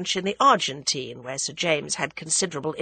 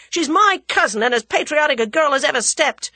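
loud, higher shout; 8 semitones higher, 7 dB louder than usual. Acted. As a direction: defend her at full volume. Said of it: "shouted Julius".

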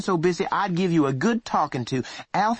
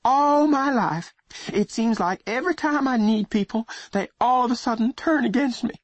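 high, shaky voice near tears; trembling voice; 4 dB louder than usual. Acted. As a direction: plead with a tremble in your voice. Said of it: "her voice was trembling".

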